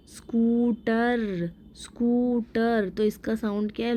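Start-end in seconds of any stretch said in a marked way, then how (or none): 0.1-2.9 स्कूटर slowly with the oo drawn out; slow teacher's स्कूटर, long oo. Shown as a demonstration. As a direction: stretch the first vowel of स्कूटर. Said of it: "long है".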